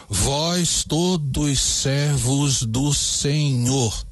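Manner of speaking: very slow, every syllable spaced out; measured scansion read; syllable counting. Shown as a demonstration. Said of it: "Vamos contar essas sílabas".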